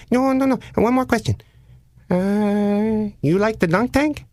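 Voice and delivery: high, sing-song creepy caller voice; odd prank caller. Retold or imitated. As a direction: play the weird caller way up high. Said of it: "the voice at the other end says".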